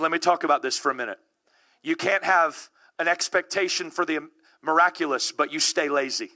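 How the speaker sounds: normal talking voice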